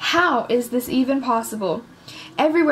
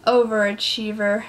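plain reading voice

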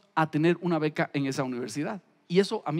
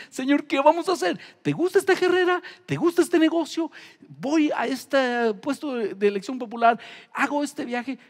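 higher, louder pleading prayer; fervent prayer; 6 semitones higher, 3 dB louder than usual. Acted. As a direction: ask God earnestly, slowing down. Voices a prayer to God.

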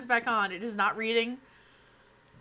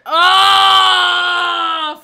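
loud frustrated wail; frustrated cry; 7 semitones higher, 17 dB louder than usual. Real, not acted. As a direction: wail out in dismay. Something went wrong onscreen.

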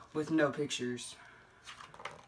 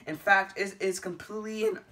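plain reading voice

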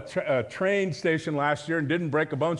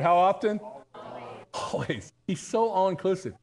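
normal talking voice

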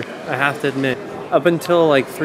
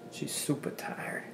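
tired whisper; 18 dB quieter, slower than usual. Real, not acted. Late-night tired whisper aside.

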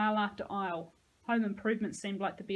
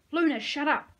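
loud, higher snap at the dog; shout at dog; 6 semitones higher, 8 dB louder than usual. A narrator's reading. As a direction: cut the barking off.

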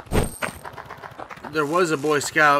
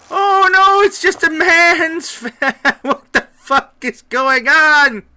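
very high, loud wail breaking into laughter; real laughing shriek; 14 dB louder, slower than usual. Real, not acted. Player baffled by what is going on, high laughing shriek. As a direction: shriek it in disbelief, stretched out and laughing.

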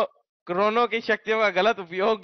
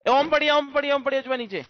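much higher, quick repeated callout; excited callout; 8 semitones higher than usual. Real, not acted. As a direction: shout out the find to your teammate. Spotting loot excitedly. (उत्साहित ऊँची पुकार)

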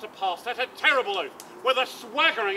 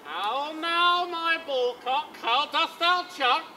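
put-on higher, slow cooing baby talk; mock cooing; 6 semitones higher, 4 dB louder, much slower than usual. Sir Toby teasing Malvolio in a mock cooing voice. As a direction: coo at him like a pet.

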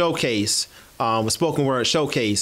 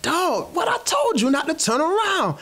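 higher-pitched mock scold to a friend; scolding whisper quote. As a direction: hiss it like you're scolding your buddy for getting caught. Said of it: "I'm like".

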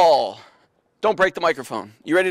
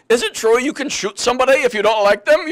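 higher, louder mock German accent; hammed-up German-accented question; 5 semitones higher, 5 dB louder than usual. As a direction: play a German cabbie asking in accented English. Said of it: "stop doing your German accent".

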